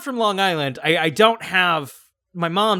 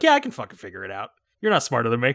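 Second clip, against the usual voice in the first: confident; 6 semitones lower, much faster than usual. Retold or imitated. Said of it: "a constant confident".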